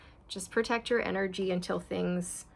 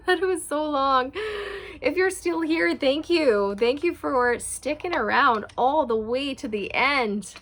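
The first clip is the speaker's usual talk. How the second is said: bright laughing thanks, higher and louder; laughing apology; 6 semitones higher, 6 dB louder than usual. Real, not acted. Vlogger thanking viewers for staying, laughing and loud. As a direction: laugh through the thank-you.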